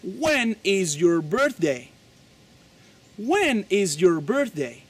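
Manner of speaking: slow, clear teaching repetition of When is your birthday; slow model question. Shown as a demonstration. Teacher modeling the birthday question. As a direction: drill it slowly for copying. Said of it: "Y tú le dices".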